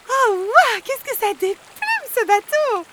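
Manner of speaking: very high, loud, squealing glee; thrilled swan. A narrator's reading. Swan Bianca racing the boat, squealing loud and high. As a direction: scream with joy at the speed.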